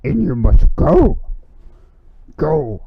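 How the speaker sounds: a hammed-up Yoda voice; Yoda impression. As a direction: show him a better Yoda. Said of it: "I'm gonna do a better Yoda impression".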